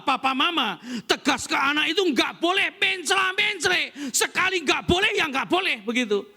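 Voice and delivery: loud, high, forceful sermon delivery; full-force preaching. Real, not acted. Preacher urging parents to be firm and united, full-force preaching. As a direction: drive it home at full volume to the congregation.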